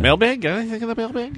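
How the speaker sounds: playful sing-song question; high, bright sing-song question. Acted. Host calls for mailbag, high.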